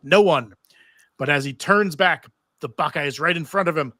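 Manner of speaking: normal talking voice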